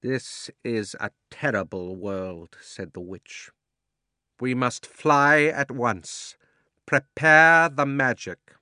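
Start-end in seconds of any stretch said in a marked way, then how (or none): 0.0-2.6 slow, cold queen's voice; a haughty witch, measured and icy; stern witch voice. Acted. The witch speaks. 4.4-8.5 high, loud, slow royal command; an imperious queen giving orders; commanding witch. Acted.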